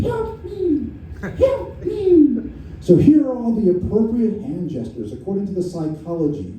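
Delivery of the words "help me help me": much higher, thin mock cry for help; tiny victim's cry for help. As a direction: squeal it like the little fly-man caught in the web. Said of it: "had a voice like the victim guy stuck in the spider web".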